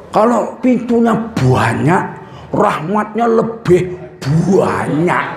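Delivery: slow drawn-out Javanese banyak as buanyak; Javanese accent. Retold or imitated. Preacher imitating how Javanese people say many. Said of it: "Itu kalau orang Jawa itu".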